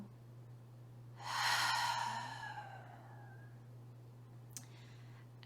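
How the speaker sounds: long audible exhale through open mouth; cleansing sigh. Shown as a demonstration. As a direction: release a deep breath slowly. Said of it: "With an open mouth".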